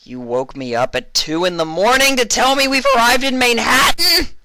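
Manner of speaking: shrieking outrage; screech. A narrator's reading. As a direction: explode at the assistant. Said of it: "Vinyl screeched".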